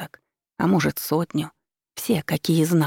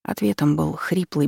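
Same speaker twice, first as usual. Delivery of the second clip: usual speaking voice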